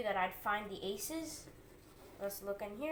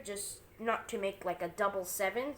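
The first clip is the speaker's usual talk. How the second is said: ordinary talk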